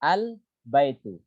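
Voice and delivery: Al-baitu said slowly, lam held closed as sukun before the ba; al-baitu with silent-style lam. Shown as a demonstration. Teacher demonstrating al-qamariyah to students, slow careful al-baitu. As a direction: drawl out Al-baitu syllable by syllable, lam clearly sukun.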